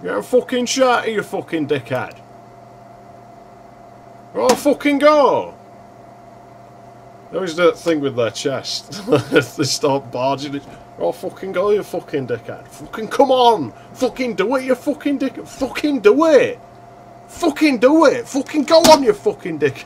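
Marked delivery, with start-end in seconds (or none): 0.0-5.5 high, loud chav taunt shouting at the screen; hammed-up chav heckle; mock chav yell. Voicing chavs squaring up in game. 11.0-19.8 high-pitched, loud chav goading, climbing to a shout; hammed-up chav shouting match; mock chav yell. Voicing chavs squaring up in game.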